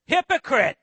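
loud, sudden accusation; indignant rebuke. Acted. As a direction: fire the word at him with authority. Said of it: "gonna get a little bit indignant right back".

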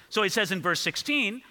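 ordinary talk

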